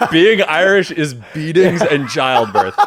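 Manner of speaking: slow, laughing repetition of the joke. Real, not acted.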